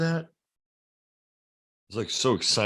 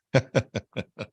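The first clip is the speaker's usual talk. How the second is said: real hearty guffaw; big belly laugh; 3 semitones higher than usual. Real, not acted. Host shows his giant laugh, a big belly guffaw. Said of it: "See, there it is".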